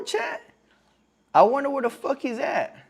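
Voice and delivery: ordinary delivery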